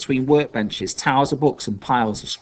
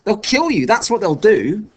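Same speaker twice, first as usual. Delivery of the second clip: high, raised, exasperated warning; heated warning; 10 semitones higher, 5 dB louder than usual. A narrator's reading. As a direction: drive the danger home, pitch up. Acted quarrel, urgent warning.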